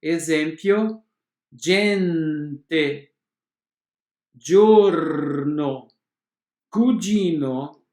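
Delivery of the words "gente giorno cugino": gente, giorno and cugino with a "dj" sound. Shown as a demonstration. Teacher to students showing g pronounced dj. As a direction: model each g word with a clear "dj". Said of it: "o som é de D G".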